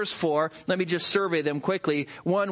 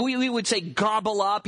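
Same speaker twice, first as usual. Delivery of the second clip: ordinary talk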